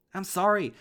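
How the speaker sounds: very loud, high sorry; frantic shouted sorry; screamed apology. Acted. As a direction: yell the apology in panic. Apologizing to dad, desperate.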